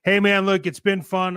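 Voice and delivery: normal talking voice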